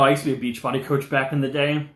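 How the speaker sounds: ordinary delivery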